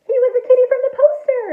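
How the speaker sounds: high, loud, fast narration; excited reveal. A narrator's reading. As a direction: burst out with the big discovery. Big reveal in story.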